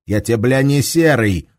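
sharp, angry rebuke. Acted. Commander snaps at Shams, angry rebuke. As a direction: put the private in his place, angrily. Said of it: "злобно ответил Лихолетов".

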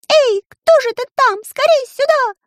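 very high, loud bird call; bird shouting. A narrator's reading. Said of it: "закричал Чиж".

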